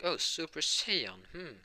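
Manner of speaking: high, slow, musing repetition; All Might. Acted. Hero ponders the boy's quirk name, musing. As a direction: echo the name slowly, mulling it over. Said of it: "oh, All Might".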